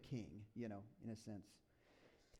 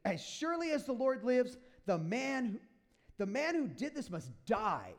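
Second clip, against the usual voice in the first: higher, louder, outraged royal decree; a retold angry oath, pitched up and loud; David losing it; 7 semitones higher, 6 dB louder than usual. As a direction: thunder the verdict like a furious king. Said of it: "He goes, and he said to Nathan".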